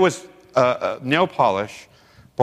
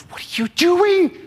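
high, slow, shrill-free alarm; alarmed mother; 3 dB louder than usual. Retold or imitated. Mother confronting the youth pastor, high and slow. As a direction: pitch way up as the worried mother. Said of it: "His mother come up to me later and goes".

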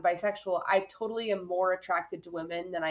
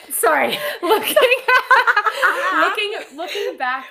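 real giggling fit, high and loud; laughing; 11 semitones higher, 11 dB louder than usual. Real, not acted. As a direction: try to get the words out through laughter. Cracks up while trying to continue.

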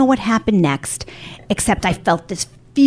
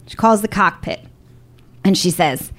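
normal talking voice

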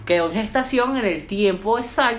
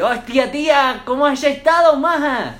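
mock Spanish from Spain accent, higher and louder; 7 semitones higher, 5 dB louder, slower than usual. Pretending to arrive in Spain, greeting a friend in a mock Spanish accent. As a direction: ham up a Madrid greeting. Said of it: "¿Qué es ese, qué es ese acento?".

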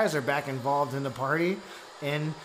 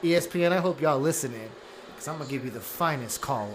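announcer voice; 3 semitones lower, 3 dB quieter than usual. Acted. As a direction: call the game like a radio host. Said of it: "How you like that announcer voice?".

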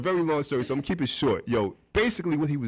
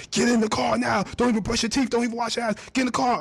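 high-pitched insistent phone rant; retold urgent order; 12 semitones higher than usual. Producer calls the tired engineer out of the house, urgent high order. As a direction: rush someone out the door, voice pitched way up.

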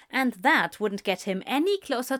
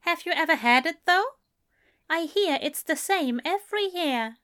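higher, quick, curious gentleman; Aziraphale's voice; 8 semitones higher than usual. A narrator's reading. Aziraphale pressing Crowley about the cheese, higher and quicker. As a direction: pitch it up and press on eagerly.

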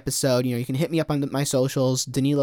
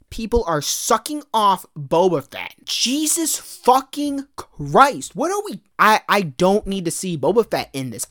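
exasperated rant; 4 semitones higher than usual. Real, not acted. Podcaster ranting to listeners about Boba Fett returning, exasperated and high. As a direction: pitch climbing high, swearing in disbelief, then rattling fast. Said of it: "my mini rant about Boba Fett".

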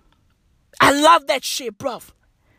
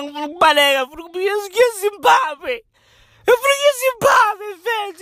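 put-on higher, fast, panicky Zulu-accented wail; mock Zulu wailing; 5 semitones higher, much faster than usual. Mocked man wailing in Zulu about fleeing. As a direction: gabble in Zulu desperate to flee. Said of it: "what happened to your proper Zulu accent?".

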